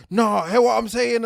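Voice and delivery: loud, insistent high protest. Retold or imitated. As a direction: push back hard, voice up. Retelling arguing back at critics.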